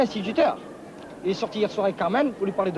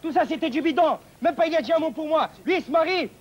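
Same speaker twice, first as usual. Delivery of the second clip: high-pitched, indignant tirade; worked-up anger; 5 semitones higher than usual. Acted. Jilted groom threatens the supposed liar, agitated high rant. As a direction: rant on higher and higher, ready for a fight. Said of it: "Ne vous énervez pas".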